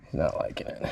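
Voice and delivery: low, hushed whisper. Real, not acted. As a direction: murmur it to yourself. Watching fish on graph.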